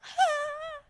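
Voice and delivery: high, airy mock cry of rapture; ecstatic cry. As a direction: let out a sudden high cry of joy. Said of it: "you're like".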